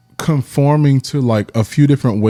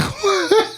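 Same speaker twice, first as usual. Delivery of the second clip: short, high burst of laughter; 3 dB quieter than usual. Real, not acted. Speaker laughing at the story.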